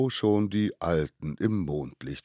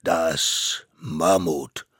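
MAMMUT very slow and loud, falling at the end; grand announcement; 8 dB louder, much slower than usual. Acted. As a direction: judge solemnly announcing MAMMUT. Court names its witness. („Mammut“ langsam, laut, feierlich verkündet)

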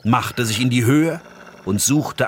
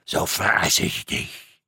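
menacing wolf threat; 6 semitones lower, slower than usual. Acted.

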